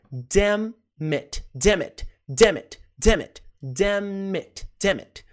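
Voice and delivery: damn it said as dem et, slow and clear; pronunciation drill. Shown as a demonstration. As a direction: repeat damn it carefully as dem-et. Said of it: "preste atenção na pronúncia".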